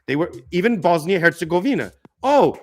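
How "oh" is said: high mocking put-on voice; mocking impersonation. Mocking a gossipy American over a scandal, high and put-on. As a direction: squeal it out like a gossiping caricature. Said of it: "I'm not gonna do this impersonation".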